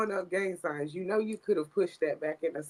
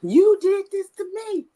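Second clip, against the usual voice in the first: mocking echo; 12 semitones higher, 5 dB louder than usual. Real, not acted. Cohost teasingly echoing the guest's complaint, high and loud. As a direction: echo it much higher and louder, teasing.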